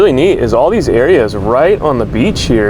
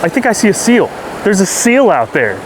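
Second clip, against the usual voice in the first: excited; 7 semitones higher than usual. Real, not acted. Spotting a seal from shore.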